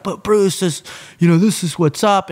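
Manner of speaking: imitating a flustered excuse; retold protest. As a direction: plead your case, acting it out. Retells pleading with his boss.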